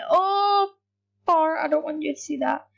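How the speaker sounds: ordinary talk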